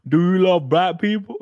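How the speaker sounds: comic impression in an altered voice; put-on interviewer voice, amused; Jesse Lee Peterson. Streamer quoting a favorite personality for laughs, comic impression. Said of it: "God, I love Jesse Lee Peterson".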